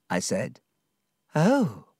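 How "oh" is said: deflated oh. Acted. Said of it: "Dora was less excited now".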